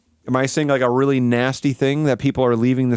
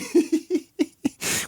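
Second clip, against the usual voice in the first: high giggle; 3 dB quieter than usual. Real, not acted. Laughing at comedy music.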